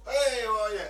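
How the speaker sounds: high, loud mock welcome; greeting host. Barbecue host opens the door to guest, loud greeting. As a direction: call out a hearty hello.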